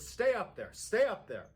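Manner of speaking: loud, high urgent order; urgent shout. Retold or imitated. Husband orders his wife to stay upstairs, loud urgent shout. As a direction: yell it up the stairs to keep her safe. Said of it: "And he goes".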